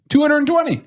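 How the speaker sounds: high, loud triumphant reveal; excited announcement. Real, not acted. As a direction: proudly blurt out the number. Announces surprising fact.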